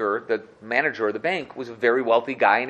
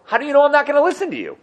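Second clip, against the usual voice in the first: much higher, fast incredulous squeak; can't believe what he just heard; high, quick disbelieving outburst; 14 semitones higher, 4 dB louder, faster than usual. Acted. Manager incredulous at the Rebbe.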